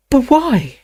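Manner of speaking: put-on high, worried girl; young girl voice. Hermione asks, voiced higher.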